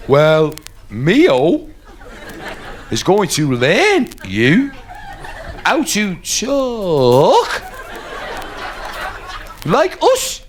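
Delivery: slow, broken phrases with big rising swoops; talking to a foreigner. Acted. Scouse Columbus teaches the chief to talk, slow exaggerated phrases. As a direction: stretch each chunk out and swing the pitch up. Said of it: "he could talk with his hands".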